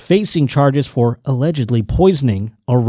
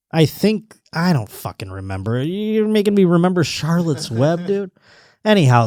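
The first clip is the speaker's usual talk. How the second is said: normal talking voice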